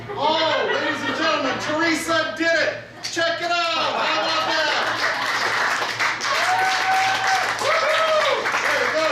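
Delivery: put-on kids party magician. Magician presenting trick result.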